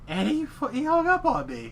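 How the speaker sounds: high, loud indignation at the cop hanging up; indignant disbelief. Real, not acted. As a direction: blurt it out in disbelief. Indignant punchline of the story.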